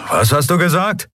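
high-pitched shouted question; calling out. Acted. Admiral who can't hear over engines, calling out high. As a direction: ask as if over roaring engines.

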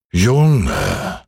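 ein Hauch von altem, geduldigem Mond; milde, gelassen mahnend; gutmütiger Mond. Acted. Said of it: "sagte er". (leicht gespielte Mondstimme)

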